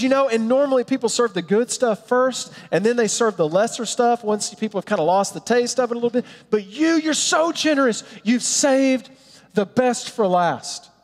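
high-pitched, delighted acted praise; excited master of the feast. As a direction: gush to the groom as a thrilled planner. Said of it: "I just think of somebody just excited".

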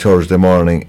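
normal talking voice

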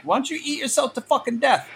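a quick, high take on Pesci; Pesci impression. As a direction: rattle off the insult as a lightly voiced Pesci. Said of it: "Joe Pesci's sitting there like".

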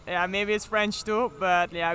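ordinary delivery

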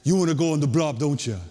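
mock Hawaiian accent, set apart from own voice. Counselor asking a kid about the blob, Hawaiian accent. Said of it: "in this Hawaiian accent".

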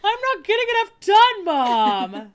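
high, loud whiny pet voice. Acted. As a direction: whine it like a needy cat. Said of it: "Doomkey's like".

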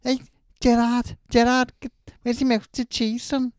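put-on loud, high Scouse accent. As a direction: Liverpool Scouse voice shouting the sign-off. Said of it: "schedule with Scouse accent".

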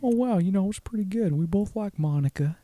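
high, hushed Owen Wilson; imitating Owen Wilson; Owen Wilson impression. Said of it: "Owen Wilson and Bill Clinton".

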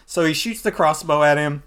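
normal talking voice

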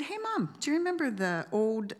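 calling out cheerfully. Retold or imitated. Calling out to mom.

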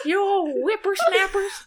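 put-on cranky old man yelling, high; old prospector. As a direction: shake your fist at the kids. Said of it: "an old man standing on his lawn".

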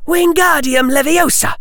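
put-on high-pitched girl's incantation; urgent spell-casting. Susan casts to catch flung Hannah, urgent incantation.

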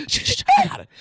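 high urgent shushing; hushing. Retold or imitated. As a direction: shush him, eager to take over. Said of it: "I was like".

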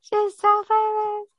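shrill, very high fangirl squeal; man doing a woman's voice. Pretend. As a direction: squeal like a starstruck fan. Screaming over a famous friend.

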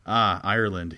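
light Irish leprechaun lilt. A narrator's reading. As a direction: read it as a slurring leprechaun. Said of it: "he slurs in a leprechaun voice".

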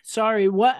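ordinary delivery

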